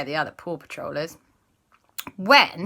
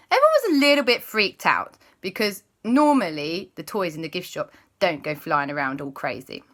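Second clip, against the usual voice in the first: ordinary delivery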